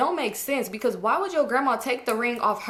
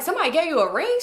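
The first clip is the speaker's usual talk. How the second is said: surprised uncle; 6 semitones higher, faster than usual. Retold or imitated. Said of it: "He was like".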